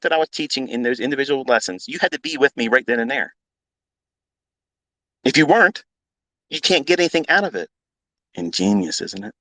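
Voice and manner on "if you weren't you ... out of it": normal talking voice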